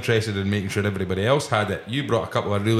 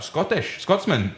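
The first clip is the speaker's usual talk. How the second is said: put-on a light Indian bartender accent, pitched up; imitated Indian accent; 7 semitones higher, slower than usual. Indian bartender guessing he's Scottish, imitated Indian accent. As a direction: Indian barman clocking a Scotsman, mock Indian accent. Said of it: "Is that allowed to do that accent?".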